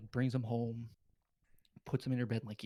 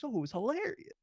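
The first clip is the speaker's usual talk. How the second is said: much higher-pitched mock confession voice; gloating killer impression; 12 semitones higher than usual. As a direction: play a killer casually recalling it, pitched way up. Confessing killer gloats in retold film scene.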